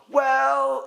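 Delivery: "Well" said high and stretched, buying time; drawn-out filler. Shown as a demonstration. Teacher shows a stalling "Well", high and drawn out. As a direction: hold "Well" up high while thinking. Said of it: "you just kind of taking time".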